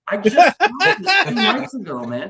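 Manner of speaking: high, loud, laughing through it; can't believe it's happening, laugh it out; laughing disbelief. Retold or imitated.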